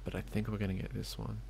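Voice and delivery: hushed and quick, sneaking up. Real, not acted. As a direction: keep it low so they don't hear. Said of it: "Why am I whispering?".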